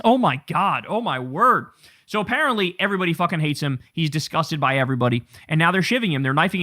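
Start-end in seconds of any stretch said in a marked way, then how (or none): none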